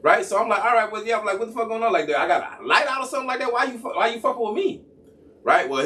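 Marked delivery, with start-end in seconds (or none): 0.6-1.2 high-pitched impatient reply; retold exasperation; annoyed. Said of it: "So I'm clearly getting annoyed". 1.4-1.9 high, exasperated question; retold indignant protest; annoyed. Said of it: "So I'm clearly getting annoyed". 2.0-4.8 high-pitched indignant questioning; retold testy challenge; annoyed. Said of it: "So I'm clearly getting annoyed".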